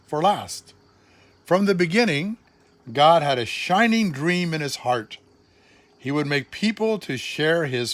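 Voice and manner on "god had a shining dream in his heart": ordinary delivery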